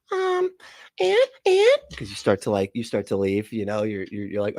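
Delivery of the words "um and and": put-on very high-pitched, slow little-kid voice; toddler playing prophecy. Host mocks the prophecy as toddler play, squeaky toddler voice. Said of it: "This is like a toddler doing, playing prophecy".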